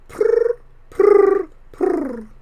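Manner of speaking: loud, high rolled purrs, falling away; cat purrs. A narrator's reading. Thelma settling on Hank's lap, high loud purrs. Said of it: "and said".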